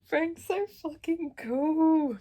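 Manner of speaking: high, loud, slowly stretched gush; swooning over a favourite character; loud, slow fangirl gush. Real, not acted. Gushing about Frank.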